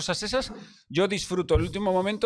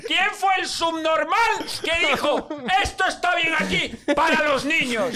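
high, slow, laughing rant; high-pitched laughing tirade; outraged yell; 10 semitones higher, slower than usual. Retold or imitated. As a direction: shout it high and slow at whoever built this. Retelling his rant at ride.

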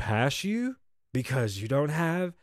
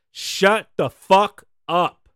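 slow, louder "Shut the fuck up"; telling off; 8 dB louder, much slower than usual. Retold or imitated. To people wasting his time, slow and loud. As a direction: lay each word down hard and slow.

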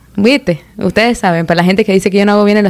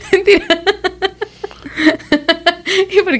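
high laugh through the word; laughing hard at her own tease; giggling; 11 semitones higher, 4 dB quieter than usual. Real, not acted.

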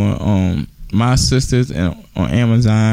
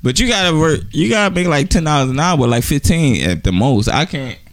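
raised-pitch playful voice; playful high voice; 6 semitones higher than usual. Pretend. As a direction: tease it, pitched up. Playing the man who pays.

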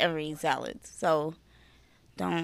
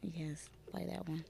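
whisper; 2 semitones lower, 18 dB quieter, slower than usual. Real, not acted. Setting mood for sexy voice.

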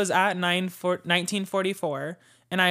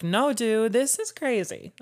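higher-pitched excited insistence; 5 semitones higher, slower than usual. Retold or imitated. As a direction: stretch out how crazy it is. Insisting the coincidence is wild.

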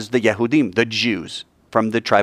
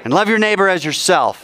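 with gusto; 6 semitones higher than usual. Shown as a demonstration. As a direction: lead the congregation in a full-voiced prayer call. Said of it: "say it with some gusto".

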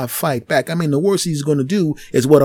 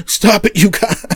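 laughing teacher; 5 semitones higher, 4 dB louder than usual. Retold or imitated. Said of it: "the teacher was kinda laughing like".

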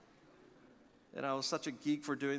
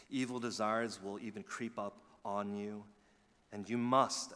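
ordinary talk

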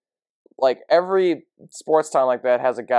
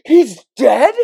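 much higher, louder startled question; shocked disbelief; 10 semitones higher, 8 dB louder than usual. Retold or imitated. Server retelling her stunned reaction to the news. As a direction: gasp it out in disbelief. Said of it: "I was like".

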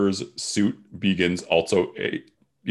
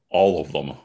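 deeper, gruff first words; low voice; 5 semitones lower than usual. Acted. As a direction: drop the voice low and terse. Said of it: "speaking for the first time".

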